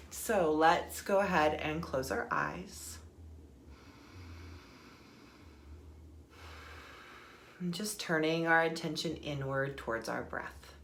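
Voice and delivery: soft, unhurried meditation guide voice; guided meditation. Acted. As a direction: ease them inward with calm breath cues.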